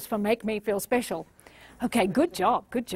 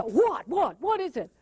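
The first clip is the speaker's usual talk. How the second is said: impatient what; 4 semitones higher than usual. Retold or imitated. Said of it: "They just see the".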